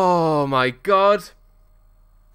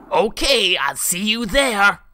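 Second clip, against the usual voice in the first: slow Simon voice, a bit higher; Simon impression; 4 semitones higher, slower than usual. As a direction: answer slowly as Simon. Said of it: "Good Simon impression".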